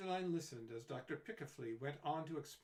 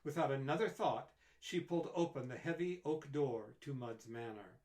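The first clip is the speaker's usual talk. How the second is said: ordinary delivery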